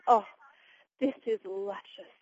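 slow, appreciative compliment, drawn out; savouring elderly mother, imitated. Mother praising the meal to her daughter, appreciative. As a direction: imitate Mom slowly savouring every word.

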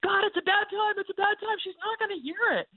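high, anxious plea; pleading. Retold or imitated. Speaker pleading with God in the line, high anxious plea. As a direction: beg God to call it off. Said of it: "my first response is".

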